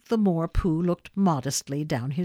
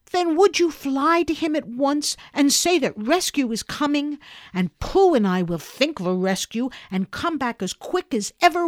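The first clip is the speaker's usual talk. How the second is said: put-on higher, eager boy voice; a boy pressing the others to act now; urgent Christopher Robin; 7 semitones higher, 3 dB louder than usual. A narrator's reading. Christopher Robin urges Owl to rescue Piglet, eager and higher.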